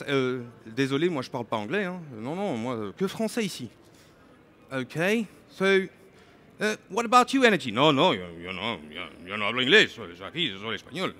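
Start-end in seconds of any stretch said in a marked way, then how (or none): 0.0-3.7 put-on low, mumbled French-accented refusal; mock French accent. Mobility manager refuses in French. 7.7-11.1 mock Spanish accent. Energy manager refuses in Spanish accent.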